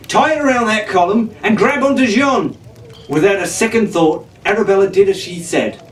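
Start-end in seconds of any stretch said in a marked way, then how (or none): 0.1-2.6 high, urgent instruction; exasperated. A narrator's reading. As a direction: bark out the plan in a hurry. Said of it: "he said in exasperation".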